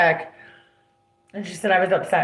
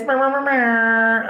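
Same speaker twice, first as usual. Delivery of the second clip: playful brr; 5 semitones higher, 4 dB louder, slower than usual. Real, not acted. Playful noise about boys.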